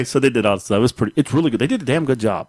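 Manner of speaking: ordinary talk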